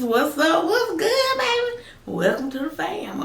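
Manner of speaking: high, bright welcome; excited high greeting. Real, not acted. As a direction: greet them with a big high hello. Greeting new viewers warmly.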